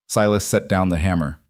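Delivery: plain reading voice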